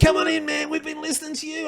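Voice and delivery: high-pitched partygoer calling out; rowdy welcome. Retold or imitated. Partygoers inviting the prankster in, rowdy welcome. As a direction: imitate an excited host hollering a welcome.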